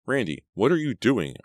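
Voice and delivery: high-pitched, alarmed cry. A narrator's reading. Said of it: "I cried".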